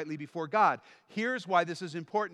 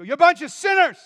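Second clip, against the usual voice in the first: much higher, louder yell; imitated shouting preacher; 11 semitones higher, 14 dB louder than usual. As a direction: bark it at the congregation. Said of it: "start all of his messages pretty much like John the Baptist".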